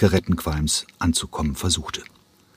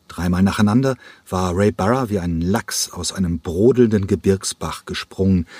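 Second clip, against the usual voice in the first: plain reading voice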